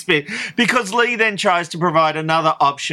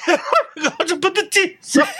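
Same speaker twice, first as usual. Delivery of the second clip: laughing; 12 semitones higher, much faster than usual. Real, not acted. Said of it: "we haven't heard you cry".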